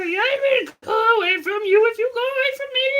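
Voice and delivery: high, silly puppet squeak; squeaky outside voice. Pretend. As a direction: squeak back in the same voice. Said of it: "And I'll go".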